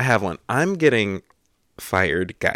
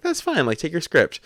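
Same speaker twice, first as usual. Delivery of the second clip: breezy crew member; 8 semitones higher than usual. Retold or imitated. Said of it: "And they're like".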